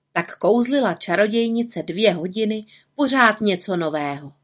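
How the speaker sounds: ordinary delivery, nothing marked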